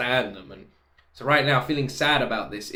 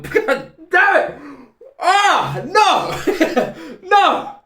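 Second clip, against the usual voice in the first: high, loud, laughing cries of no; overwhelmed fan screaming no; anguished yells; 9 dB louder, much slower than usual. Real, not acted. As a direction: howl no in mock despair.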